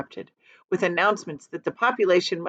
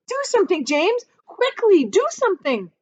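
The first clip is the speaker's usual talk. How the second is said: high, loud desperate begging; frantic plea; 11 semitones higher, 4 dB louder than usual. Acted. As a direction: urge James to act in panic. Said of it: "cried the earthworm".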